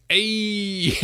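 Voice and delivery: high loud cheerful greeting; excited hey. Retold or imitated. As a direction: call out happily as you arrive. Greeting on arrival, acted quote.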